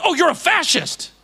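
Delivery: scandalized mock outcry; mock accusation. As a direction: mimic someone indignantly accusing you. Mimics critics accusing him.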